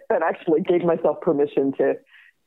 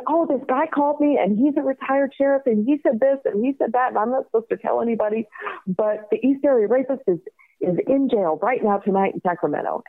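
high, gushing rush of news; excited teenager; 7 semitones higher than usual. Retold or imitated. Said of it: "like an excited teenager".

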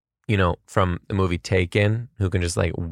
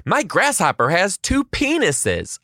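put-on high, slow child announcement; imitated child, boldly announcing to a silent class; confident kid; 11 semitones higher, slower than usual. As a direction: proclaim it proudly as a small kid. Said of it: "with full-blown confidence".

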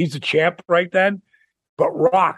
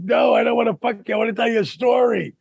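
high, fast retold pleading; excited insistence; 7 semitones higher, faster than usual. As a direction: rush it out high, eager to be heard. Excited husband waking his wife, retold.

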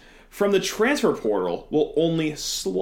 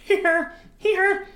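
silly clown. Pretend. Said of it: "the world's biggest circus clown".

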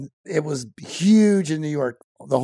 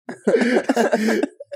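bright burst of laughter, pitched up; real laugh; 8 semitones higher than usual. Real, not acted. Bartender laughs at his own mission speech, real laughter. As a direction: let a real laugh break out.

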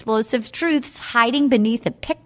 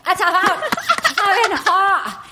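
put-on Scottish accent; 7 semitones higher than usual. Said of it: "try to do that Scottish accent".

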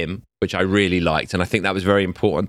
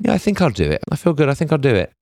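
breezy; 6 semitones higher, faster than usual. Retold or imitated. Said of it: "And she was like".

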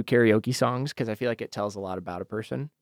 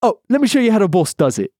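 raised cocky echo of the line; Phoenix impression; 7 semitones higher, 3 dB louder than usual. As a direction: swagger it back, pitched up. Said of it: "That's Phoenix".

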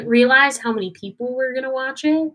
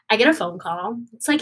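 normal talking voice

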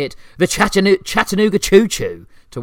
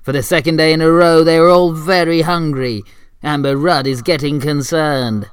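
narration; 3 semitones higher, 8 dB louder than usual. Acted. Narrator of comic sketch.

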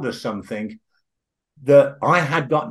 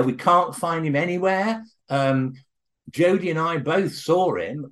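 ordinary talk, nothing marked

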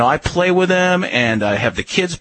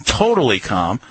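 ordinary delivery

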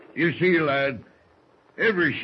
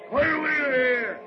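shouted commands; 13 semitones higher than usual. Acted. First mate hollering orders at the sailors, full bellow. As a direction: yell each order across the deck.

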